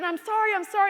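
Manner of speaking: high, frantic repeated apology; flustered apology. Retold or imitated. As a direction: plead as an overwhelmed clerk. Clerk apologizing under a customer's yelling.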